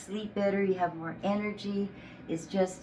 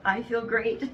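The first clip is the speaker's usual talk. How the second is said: laughing; 4 semitones higher, 3 dB louder than usual. Real, not acted. Laughs after her healing story.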